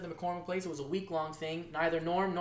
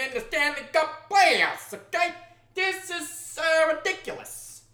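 exasperated; 11 semitones higher, 8 dB louder, slower than usual. Real, not acted. Outrage over the captain vote.

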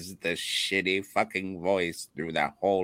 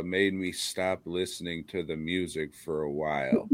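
usual speaking voice

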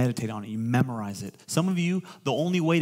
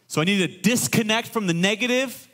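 preaching; 7 semitones higher than usual. Real, not acted. Raised preaching.

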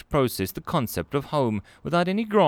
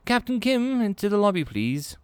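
bright high welcoming voice, clearly higher; a cheerful human clerk, pitched up; friendly receptionist Melanie; 11 semitones higher than usual. Acted. Clerk greets a nervous alien visitor, high and friendly.

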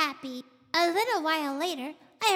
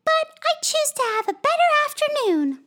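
put-on helpful girl friend; 6 semitones higher, 5 dB louder than usual. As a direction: coax him into a better mood as young Mabel. Mabel gives advice.

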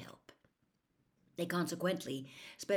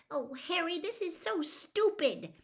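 put-on exasperated girl; 12 semitones higher than usual. A narrator's reading. Hermione exasperated.

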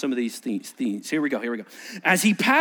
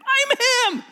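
very high strained squeal; beggar's squeak; 4 dB louder than usual. Acted. Beggar pleading with doubters in a high squeal. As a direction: squeak it out desperately.

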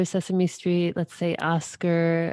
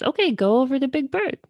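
higher and fast, a light puppet voice; Cookie Monster voice; 7 semitones higher, much faster than usual. Retold or imitated. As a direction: rattle it off like a cheerful monster puppet. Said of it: "And so Cookie Monster says".

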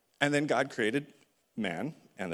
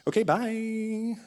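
breezy goodbye; 11 semitones higher, 4 dB louder, much slower than usual. Acted. God leaving Adam and Eve.

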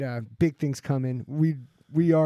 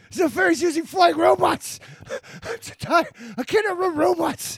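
high, slow whiny complaining voice; whiny mock voice; 12 semitones higher, 3 dB louder than usual. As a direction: play a winded stoner whining. Mocking a tired stoner chased.

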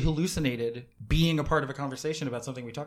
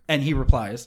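normal talking voice

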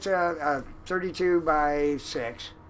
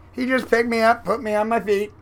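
ordinary talk

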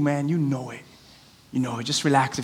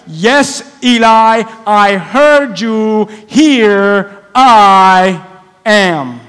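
loud, drawn-out exasperated answer; acted frustration; 6 dB louder, much slower than usual. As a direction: stretch each word in fed-up obedience. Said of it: "he's getting frustrated".

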